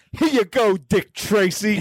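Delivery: high-pitched dopey movie-guy impression, name drawn out; put-on goofy voice, high and dragging at the end; slow stupid voice. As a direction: imitate a dim guy, stretching out the name. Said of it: "it's kind of a stupid voice".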